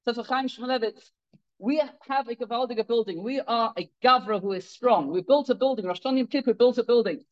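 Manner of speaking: ordinary delivery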